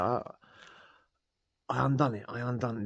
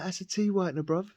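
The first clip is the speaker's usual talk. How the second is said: mate's accusation; 9 semitones higher than usual. Retold or imitated. Cellmate insisting the pill was fake, high mock voice. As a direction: voice jumps much higher, mocking the mate.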